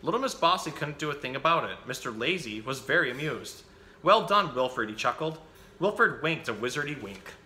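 put-on lively picture-book reading for children; animated bedtime-story reader; story narration. A narrator's reading. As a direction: read the story out with bouncy, emphatic phrasing.

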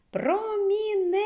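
"Промінець" called high and loud, last syllable held long; high drawn-out call on "Промінець", stress on the end; calling the word out. Shown as a demonstration. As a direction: call "Промінець" as if summoning it, stretching нець. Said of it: "покличемо".

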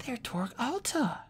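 put-on high, soft, slow girlish voice, half to herself; a young girl naming them aloud without meaning to; Sophie's voice. Sophie, thinking aloud at the table, in a soft high voice.

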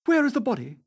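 high, urgent demand; exclaiming. A narrator's reading. Dorian clutching at hope, exclaiming urgently. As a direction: burst out with the question. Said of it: "he exclaimed".

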